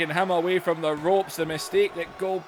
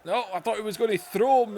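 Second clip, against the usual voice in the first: usual speaking voice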